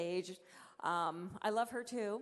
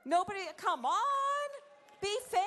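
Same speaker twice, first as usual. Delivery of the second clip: mock pleading; 11 semitones higher, 5 dB louder than usual. Real, not acted. Crowd silent, mock pleading.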